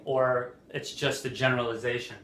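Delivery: normal talking voice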